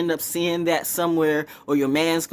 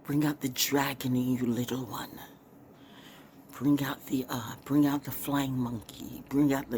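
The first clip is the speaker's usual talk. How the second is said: softer, murmured mystic elder coaxing; God Mommy voice; 6 dB quieter than usual. Retold or imitated. God Mommy urging her little one on, soft mystic murmur. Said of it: "they're like".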